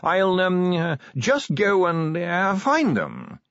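nervous, flustered. Acted. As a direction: stall on drawn-out ums as you excuse yourself. Nervous curator excuses himself, hesitating.

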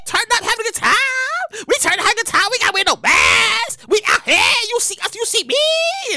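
high, loud, whooping party voice; loud high hammed-up party goer; mock partying crowd. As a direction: ham it up as a rowdy partier.

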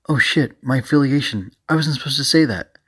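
oops, let a secret slip; flustered slip. Acted. Character blurts out a secret.

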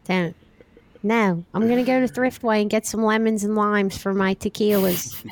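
thick Delco accent, mock-scolding. Shown as a demonstration. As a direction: put on a Delco accent for a demo. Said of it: "pretty good Delco accent".

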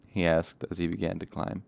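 ordinary delivery, nothing marked

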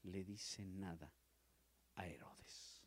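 dropping to a near whisper; sermon point sinking to a whisper; hushed. Acted. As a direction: let it fall away to a breath. Preacher drops to hushed emphasis.

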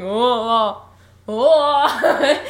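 loud, drawn-out fake moan; exaggerated, unconvincing nightmare wailing; Cowardly Lion wail. Podcaster mocks Jack's faked nightmare cries, Cowardly Lion wailing. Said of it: "sounds like the Cowardly Lion".

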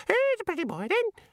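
very high-pitched little bird voice; chirping budgie. A narrator's reading. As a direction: squeak it like a talking parrot. Budgerigars voiced in story.

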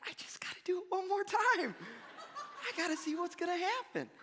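put-on very high, giggly little-kid voice; hammed-up mischievous eagerness; giddy temptation. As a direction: squeal it like a kid who can't resist. Retelling childish urge to provoke.

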